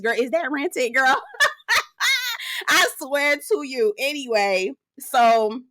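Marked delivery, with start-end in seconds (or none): 1.2-2.6 high burst of real laughter. Real, not acted. Laughs at own joke.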